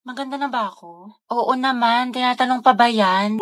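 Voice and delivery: ordinary delivery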